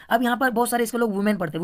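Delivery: usual speaking voice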